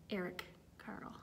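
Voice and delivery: ordinary delivery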